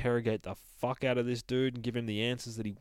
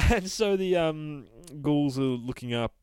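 normal talking voice

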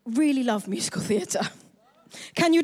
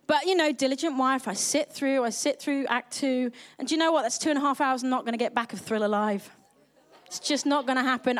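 usual speaking voice

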